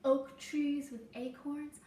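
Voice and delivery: gentle storybook read-aloud for a class; reading to children. Acted. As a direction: tell the story slowly to little listeners. Reading picture book to class.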